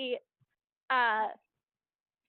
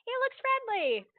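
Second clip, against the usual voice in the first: higher, sweetly teasing; 11 semitones higher than usual. Acted. Robin teases the newcomer, high sweet voice.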